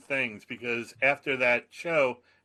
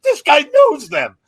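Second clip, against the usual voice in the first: much higher, much louder, slow amazed realisation; crowd won over; 14 semitones higher, 14 dB louder, slower than usual. Retold or imitated. Philly crowd realising the comic knows the band, loud amazed. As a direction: gasp it like the whole crowd suddenly gets it.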